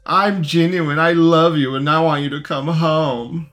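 mock sincere caller. Host parodying the praying caller, mock earnest. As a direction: parody a syrupy, sincere believer.

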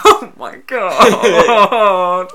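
high, loud mock wail, hugely drawn out; hammed-up, stretched-out cry of amazement; overexcited filmgoer. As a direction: imitate someone hopelessly overcome by a film. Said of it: "and she's going".

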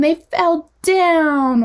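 high, very slow, with "down" stretched out; playful crashing DOWN. Shown as a demonstration. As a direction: narrate the tumble with a long falling "down". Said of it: "that loud sound while I'm saying".

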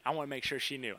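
ordinary delivery

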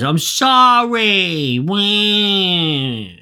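very slow, high, whiny sorry; grudging apology. Retold or imitated. Fred forced to apologize to the bird, slow whiny sulk. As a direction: drag the apology out like a sulking child.

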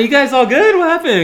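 high, loud, disbelieving question; mock astonished, pitched high and loud; surprised. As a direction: ask it amazed. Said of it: "I was like".

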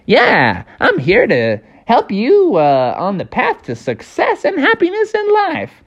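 high, swooping cartoon cricket voice; little high-voiced mentor; Jiminy Cricket. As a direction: chirp helpful advice, pitch climbing. Jiminy answers in character.

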